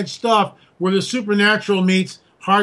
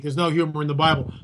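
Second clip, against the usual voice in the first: ordinary delivery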